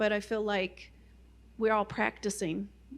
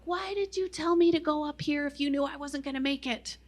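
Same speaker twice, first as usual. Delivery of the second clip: frustrated complaint; 6 semitones higher than usual. Retold or imitated. Angry complaint to God.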